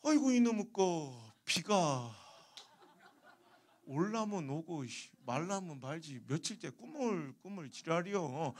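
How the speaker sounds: put-on slow, drawn-out old man's grumble; grumbling Chungcheong grandpa. Chungcheong melon seller dodging the haggler, slow grumbling. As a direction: grumble at the weather, stretching every word. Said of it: "근데 할아버지가".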